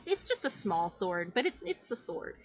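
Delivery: normal talking voice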